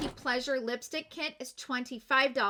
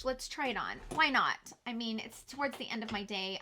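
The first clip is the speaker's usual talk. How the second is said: ordinary talk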